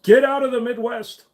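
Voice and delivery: loud, high-pitched joke yell; sudden high, comic outburst; mock shout. Mock retort to Midwest shout-out.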